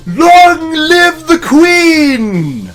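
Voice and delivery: very high, drawn-out loud cheer; crowd cheer. Acted.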